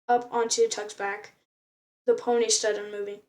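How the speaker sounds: ordinary delivery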